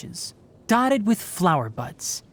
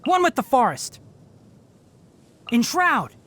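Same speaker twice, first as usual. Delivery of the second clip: high, forceful skill call; battle call-out; 7 semitones higher than usual. Acted. Game character unleashing a forest skill, battle call-out. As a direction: call out the attack with raised pitch.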